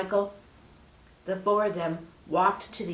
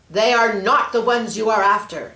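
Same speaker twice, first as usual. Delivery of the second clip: commanding order; 3 semitones higher, 8 dB louder than usual. Acted. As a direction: demand it with authority. Angel orders snake.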